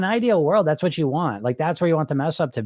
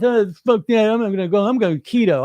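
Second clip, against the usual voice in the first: hammed-up dieter; 6 semitones higher, 4 dB louder than usual. Mocks impatient dieter's reaction.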